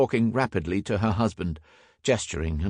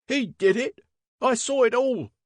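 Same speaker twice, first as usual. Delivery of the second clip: very high, shrill accusation; shrill cry; 3 dB louder than usual. A narrator's reading. Servant accuses the inspector to constables, crying shrilly. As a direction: cry out as a frightened, fierce witness. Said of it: "repeated the servant from number forty-four shrilly".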